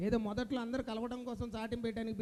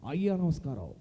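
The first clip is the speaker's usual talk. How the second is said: low, loud stylized greeting; Rajinikanth impression; 6 semitones lower, 6 dB louder than usual. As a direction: fire off the greeting with swagger. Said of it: "super star Rajinikanth గారు ఇట్లే వస్తున్నారు".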